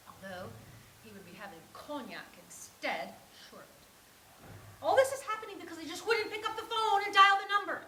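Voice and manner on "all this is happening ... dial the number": loud, higher frustrated rant; worked-up complaint, raised voice; exasperated outburst. Acted. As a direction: vent it all in one rush. Vents frustration at herself.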